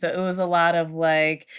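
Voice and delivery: ordinary talk, nothing marked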